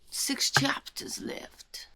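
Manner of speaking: slow, suspenseful whisper; whispered aside. Real, not acted. Reader counts down to the book's end, whispering slowly.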